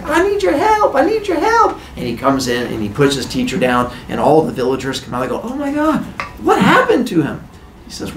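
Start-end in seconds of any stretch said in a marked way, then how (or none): 0.0-1.8 high pleading call for help; panicked teen. Retold or imitated. Heracles runs to village. 5.4-7.4 shocked villagers. Retold or imitated. Villagers shocked at dead teacher.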